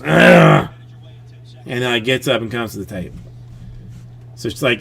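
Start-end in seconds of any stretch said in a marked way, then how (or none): 0.0-0.7 annoyed ugh. Retold or imitated. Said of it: "And she goes".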